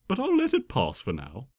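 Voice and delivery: ordinary delivery, nothing marked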